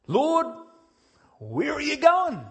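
high, loud, eager call; Peter's eager question. Acted. Peter calling after Jesus, high and eager. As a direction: cry out to the Master eagerly. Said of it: "Simon Peter said to him".

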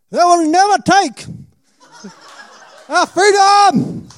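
hammed-up Scottish Braveheart freedom roar; Scottish rallying yell, mock heroic, with a laugh; Scottish accent, Braveheart. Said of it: "give me an accent".

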